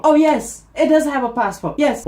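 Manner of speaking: high, loud excited yes; hammed-up eager answer; eager confirming. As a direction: jump on the question, high and loud. Eager answer about the bag.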